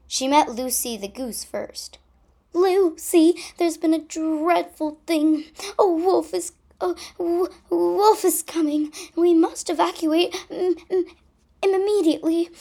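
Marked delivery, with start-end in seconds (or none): 2.5-12.5 high, loud panic; panicked cry. Acted. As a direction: cry out the alarm. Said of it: "she cried".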